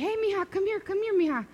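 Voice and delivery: high, friendly beckoning call; calling someone over. Retold or imitated. Man calls a lady over to him, high beckoning call.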